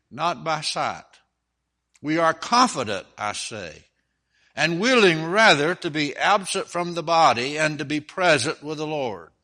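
declaimed Bible reading with strong, punched phrase openings; scripture reading. Acted. Preacher reads Second Corinthians to the standing congregation, declamatory scripture reading. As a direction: read scripture out to a congregation, measured and emphatic.

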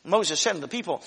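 ordinary delivery

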